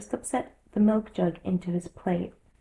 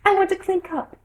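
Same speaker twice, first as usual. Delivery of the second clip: interrupting Hatter; 12 semitones higher, 5 dB louder than usual. A narrator's reading. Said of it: "interrupted the Hatter".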